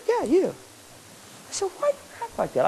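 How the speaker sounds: put-on quiet, pointed question to the kid; hushed confrontation. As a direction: lean in and say it quietly.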